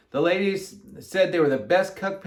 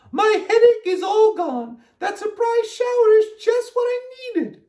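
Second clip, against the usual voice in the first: Miss Alma; 5 dB louder than usual. A narrator's reading. Miss Alma hugs Amelia Bedelia, gushing high. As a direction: gush it out way up high. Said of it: "said Miss Alma".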